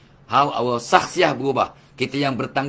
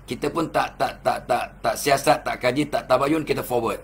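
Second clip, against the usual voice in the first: ordinary talk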